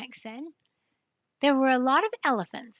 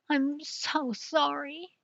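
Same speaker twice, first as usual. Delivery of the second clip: sorry crocodile; 4 semitones higher, 6 dB quieter, slower than usual. Acted. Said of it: "A big tear rolled out".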